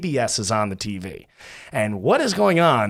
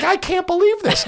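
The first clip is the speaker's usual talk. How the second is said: squealed very high in disbelief; high-pitched stunned squeal. Retold or imitated. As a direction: shriek it like you can't believe it. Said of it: "I'm like".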